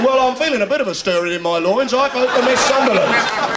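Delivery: loud mock contest voter; phone-in caller. Comedian imagining a beauty contest phone-in, mock caller. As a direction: ring in loudly with a silly vote. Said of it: "They should have a phone in".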